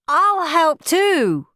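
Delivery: high, loud, drawn-out offer; eager child volunteering. A narrator's reading. Henry volunteering to help Mum, eagerly. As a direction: pipe up loudly, stretching the words.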